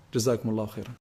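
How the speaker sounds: usual speaking voice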